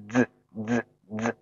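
"Z Z Z" as three separate buzzes; voiced Z. Shown as a demonstration. Teacher demonstrates the last sound for learners, buzzing voiced "Z Z Z". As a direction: hold each "Z" briefly with the throat vibrating. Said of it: "这个要声带有震动的".

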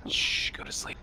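soft hushed whisper; whispering over a crib. Acted. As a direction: hush a child to sleep. Hushing the kid to sleep.

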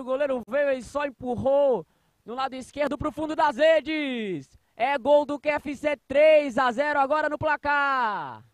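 high, loud, slow goal recap; explosive goal commentary. Real, not acted. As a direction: keep the goal joy ringing, drawn out. Recapping the goal just scored.

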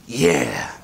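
low, drawn-out mock kid response; kids' drawn-out yeah. Dad retelling his kids' reply about breweries, drawn-out imitation. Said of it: "and they're like".

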